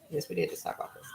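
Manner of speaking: amused whispered aside repeating her own words. Real, not acted. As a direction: whisper it like a private joke.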